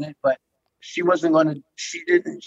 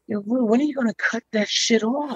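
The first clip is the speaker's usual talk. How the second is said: imitating Wendy Williams; 6 semitones higher than usual. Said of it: "he's like".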